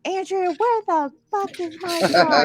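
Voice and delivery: high-pitched irate mother imitation; angry mom. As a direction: imitate mom storming in, demanding answers. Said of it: "My mom comes barging in".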